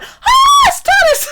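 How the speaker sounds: very high, loud re-enacted gasp and cry; excited shriek. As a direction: shriek as if suddenly spotting something amazing. Retold sudden excited sighting.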